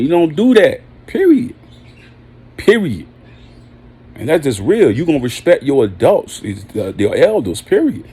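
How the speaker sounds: usual speaking voice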